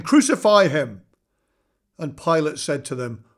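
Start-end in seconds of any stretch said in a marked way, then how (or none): 0.0-1.0 raised, louder narrated cry. A narrator's reading. Crowd cries out to Pilate, a louder narrated call. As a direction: call it out firmly, short of a shout. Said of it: "they cried out again".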